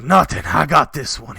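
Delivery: a bandit chief breaking into a house; bandit king. Acted. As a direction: bellow to his men.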